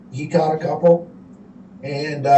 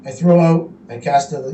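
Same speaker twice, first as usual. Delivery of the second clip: usual speaking voice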